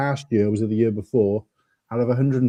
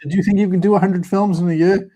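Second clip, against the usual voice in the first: a higher, lively voice for her question; quoting his partner; 8 semitones higher than usual. Retold or imitated. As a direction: put on her voice, lightly, as you quote her. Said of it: "So she said".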